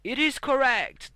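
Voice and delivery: loud, high game show reveal; excited announcement. Acted. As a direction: announce the win with a big lift. Host announces correct answer.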